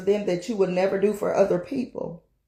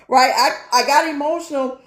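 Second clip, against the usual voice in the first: laughing; 7 semitones higher, 4 dB louder than usual. Real, not acted. Laughing at her own emotion.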